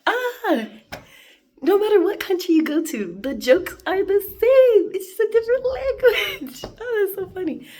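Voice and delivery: high and loud, laughing through it; delighted. Real, not acted. Delighted at the Roblox joke, laughing. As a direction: squeal it out, tickled by the joke. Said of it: "delighted laughing reaction".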